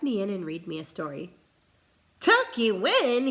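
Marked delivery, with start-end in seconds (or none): 2.2-3.3 loud, slow outraged question; bellow. Acted. Captain scoffs at tucking in, bellowing. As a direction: bellow it in disbelief. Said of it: "he bellowed".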